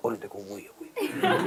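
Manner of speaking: very soft, low murmur; furtive undertone; hushed onlookers. Retold or imitated. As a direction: imitate gossip passing behind someone.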